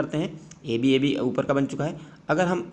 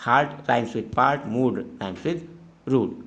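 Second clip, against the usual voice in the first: slow dictated answer; much slower than usual. Shown as a demonstration. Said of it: "आप इस तरीके से भी लिख सकते हैं".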